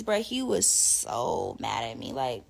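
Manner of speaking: normal talking voice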